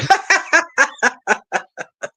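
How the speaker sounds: long, high cackling laugh; hearty laugh. Real, not acted. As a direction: burst out laughing at your own remark. Laughs after her own joke.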